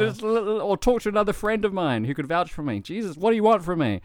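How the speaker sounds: high, loud, rushed pleading to be let go; frantic pleading. Retold or imitated. Drunk foreigner begging police for a call, frantic. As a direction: beg them to let you charge the phone. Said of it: "I said".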